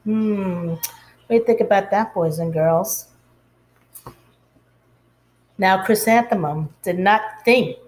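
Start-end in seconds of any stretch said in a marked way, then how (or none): none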